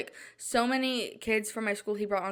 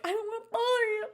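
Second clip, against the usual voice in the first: much higher squeaky whine on "bother"; mock whiny self; 13 semitones higher, faster than usual. Daughter whining back at her mom, high squeaky plea. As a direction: play your younger self pleading, pitched way up. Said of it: "And I'm like".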